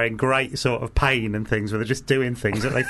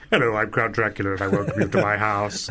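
lightly mimicked English gentleman, lower pitch; a hint of a lower-voiced, very English host; English-accented Dracula; 4 semitones lower than usual. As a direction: play the count with a plain English accent. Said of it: "in an English accent".

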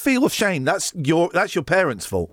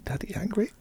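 softer, higher mock-childish question; teasing baby talk; 4 semitones higher, 8 dB quieter than usual. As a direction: needle him like a small child. Teasing after the shout.

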